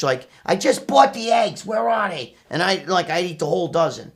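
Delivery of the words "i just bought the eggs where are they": higher, slow, half-hearted nagging mom; lightly voiced exasperated mother; mock complaining mother. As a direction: imitate mom griping about missing eggs. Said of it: "My mother would bitch".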